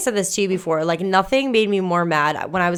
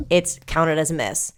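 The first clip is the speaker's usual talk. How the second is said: usual speaking voice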